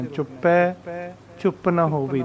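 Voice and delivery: ਚੁੱਪੈ held apart by a brief stop; short pause after ਚੁੱਪੈ. Shown as a demonstration. Teacher showing where to pause, brief stop after ਚੁੱਪੈ. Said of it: "ਚੁੱਪੈ ਤੇ ਥੋੜ੍ਹਾ ਜਿਹਾ ਰੁਕਣਾ".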